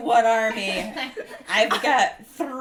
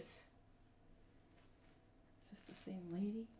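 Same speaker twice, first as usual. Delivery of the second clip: whispering; 6 semitones lower, 26 dB quieter, faster than usual. Real, not acted. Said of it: "whispered aside".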